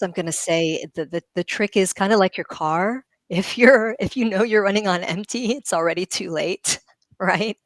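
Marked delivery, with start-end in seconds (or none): none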